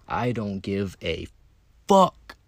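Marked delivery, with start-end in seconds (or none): 1.9-2.4 sudden loud high burst on fuck, then a laugh; defiant shout. Real, not acted. Telling podcast listeners he doesn't care, loud burst. Defiant outburst about not caring.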